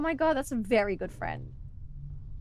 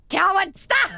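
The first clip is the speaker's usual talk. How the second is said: shout; 8 semitones higher, 7 dB louder, slower than usual. Pretend. Mock outburst at chat.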